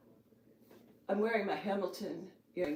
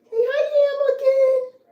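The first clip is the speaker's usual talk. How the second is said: squealy high hello to the cat; high cat greeting; 13 dB louder, slower than usual. Real, not acted.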